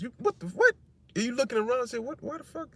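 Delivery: what-the squeal of someone stunned; shocked disbelief. Retold or imitated. Preacher acts a brother stunned by his twisted words, shocked yelp. Accused man shocked by transcript.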